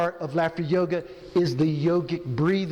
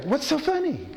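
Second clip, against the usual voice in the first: a higher, incredulous stranger; mock puzzled passerby; 5 semitones higher than usual. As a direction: play the baffled bystander. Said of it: "What do you say?".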